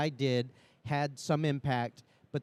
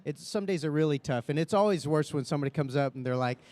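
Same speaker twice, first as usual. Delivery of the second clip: usual speaking voice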